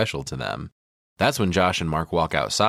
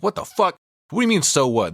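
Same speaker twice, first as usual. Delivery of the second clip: high, loud indignant outburst; angry villager shouting at the tourist, a loud mock outrage; furious villager; 10 semitones higher, 3 dB louder than usual. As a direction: blow up at him in disbelief. Ulf furious at Mark.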